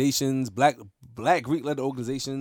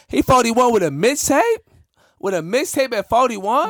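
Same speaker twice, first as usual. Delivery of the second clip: higher and louder, mocking disbelief; incredulous; 8 semitones higher, 4 dB louder than usual. Real, not acted. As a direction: scoff at it, voice rising high. Mocking an older rapper.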